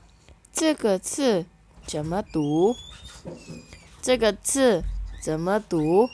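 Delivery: very slow, clear Mandarin, syllables spaced; slow model reading. Shown as a demonstration. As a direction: model it for learners to repeat. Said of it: "Kita baca ya".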